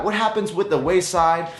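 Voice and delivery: ordinary talk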